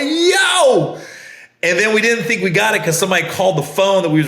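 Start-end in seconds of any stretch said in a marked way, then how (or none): 0.0-1.0 high, drawn-out retold exclamation; amazed shout. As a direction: reenact being blown away, pitched way up. Retells his moved reaction.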